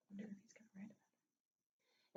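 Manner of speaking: hushed aside; soft conspiratorial whisper. Real, not acted. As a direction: whisper it so he won't hear. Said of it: "aside to audience".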